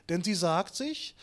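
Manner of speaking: ordinary delivery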